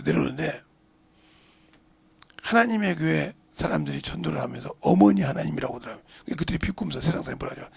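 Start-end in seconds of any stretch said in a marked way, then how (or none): none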